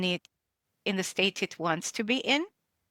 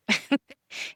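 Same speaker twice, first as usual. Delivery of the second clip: a bright burst of laughter, higher; short laugh; 7 semitones higher than usual. Real, not acted. Guest laughing at her own introduction, short bright laugh. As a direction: laugh at the absurdity of the question.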